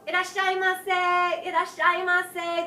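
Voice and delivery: high, loud and drawn-out sing-song greeting; shopkeeper's welcome cry. Retold or imitated. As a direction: belt it out like a shopkeeper greeting customers. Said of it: "They'll scream it at you".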